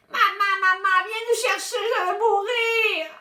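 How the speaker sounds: hammed-up little boy wail; wailing child. A narrator's reading. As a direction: cry out to mum like he's dying.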